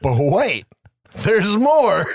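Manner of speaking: high, loud pitchman tease; mock infomercial teaser. Host teasing the post-credits scene to co-host, mock pitchman.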